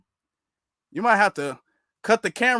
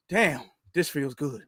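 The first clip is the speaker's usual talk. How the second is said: soft, lower sigh of relief; mock relieved sigh; 6 semitones lower, 6 dB quieter than usual. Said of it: "imitating quote again".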